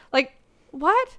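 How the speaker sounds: mildly rising, puzzled what; a first, half-disbelieving ask; baffled question. Real, not acted. To her co-host about apologizing, a mild baffled what.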